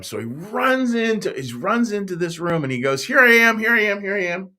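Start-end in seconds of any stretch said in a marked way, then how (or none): 3.1-4.5 loud, high, eager call; excited little boy reporting in; eager boy answering. Acted. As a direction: burst in answering the call, pitched right up.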